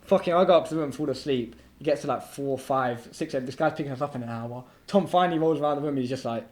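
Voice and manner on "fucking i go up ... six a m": normal talking voice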